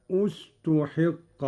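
استحق begun on u, ustuḥiqqa; damma on the hamza. Shown as a demonstration. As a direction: slowly start استحق with a clear u. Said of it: "بضم الهمزة".

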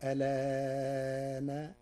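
ألان with drawn out medium vowel, very slow; slow demo of ألان; medium lengthening. Shown as a demonstration. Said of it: "فيقرأها هكذا".

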